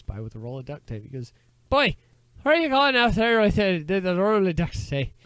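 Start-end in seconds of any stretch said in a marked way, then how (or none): 1.7-5.1 put-on high, loud Scottish-accented holler at the boy; Scottish uncle. Uncle challenging the boy by the river, Scottish shout. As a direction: joke uncle yelling in a hammy Scottish accent. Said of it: "Now he's half Scottish".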